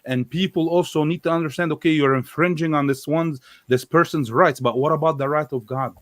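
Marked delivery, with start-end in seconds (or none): none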